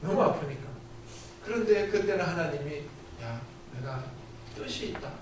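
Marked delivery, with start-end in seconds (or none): 3.2-5.1 soft, near-whispered answer; God's hushed voice. Retold or imitated. God answering the suffering pastor, hushed near-whisper. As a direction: let God answer quietly, breathy and hushed. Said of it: "이러시는 거예요".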